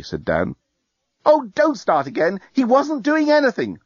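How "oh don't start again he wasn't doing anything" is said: put-on much higher, defensive young woman; Lucy's voice. A narrator's reading. Lucy brushing off Dan's complaint, high and defensive.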